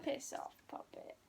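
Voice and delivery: soft whispered phrase; whispering. Shown as a demonstration. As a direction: whisper it under your breath. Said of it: "repeats the phrase softly".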